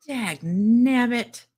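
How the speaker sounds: slow drawn-out grumble; mock exasperated. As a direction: drag out nabbit in frustration. Mock frustrated exclamation.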